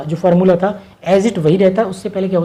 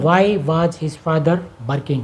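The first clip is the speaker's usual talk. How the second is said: slow dictation; 2 semitones lower, much slower than usual. Shown as a demonstration. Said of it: "teacher reads translation".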